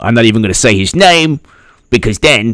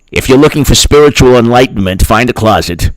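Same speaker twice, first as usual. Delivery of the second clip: ordinary delivery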